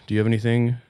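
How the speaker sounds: ordinary talk, nothing marked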